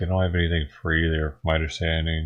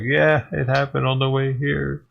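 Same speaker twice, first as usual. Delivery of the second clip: mock couple voice; 8 semitones higher than usual. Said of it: "that couple was like".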